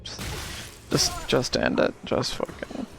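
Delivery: despairing whisper under the breath. Real, not acted. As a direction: whisper it, totally defeated. Despairing gamer at boss fight.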